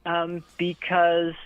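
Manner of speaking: normal talking voice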